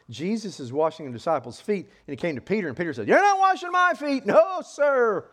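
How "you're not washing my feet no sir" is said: high, loud indignant outburst; acted loud refusal. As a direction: refuse outright at full voice. Peter refuses the washing.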